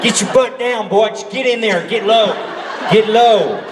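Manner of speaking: high, hammed-up Southern coaching voice; Southern accent. Stunt coordinator urging an actor to duck, hammed-up Southern accent. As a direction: call it out in a mock Southern accent. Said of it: "like, a southern accent".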